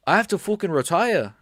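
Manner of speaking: Tom Aspinall impression. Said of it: "I'll try one more time".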